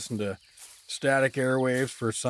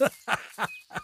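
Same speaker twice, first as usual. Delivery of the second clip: high squealing giggle while being tickled; ticklish giggle; 7 semitones higher, 5 dB quieter than usual. Acted. Doug squirms while the blue woman pokes at him, giggling. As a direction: giggle helplessly as she tickles you. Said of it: "That tickles".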